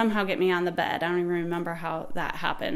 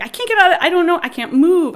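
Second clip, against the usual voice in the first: high, loud re-enacted distress; pained protest; 9 semitones higher, 9 dB louder than usual. Woman in labor stuck in the car, pained loud protest. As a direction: cry out that the body is stuck. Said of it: "I'm in so much pain".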